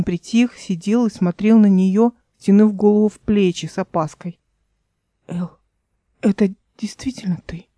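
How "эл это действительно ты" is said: a soft unvoiced whisper, timid; cautious whisper. Frightened man whispers his question to Эл. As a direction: barely breathe the question, afraid. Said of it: "осторожно спросил он".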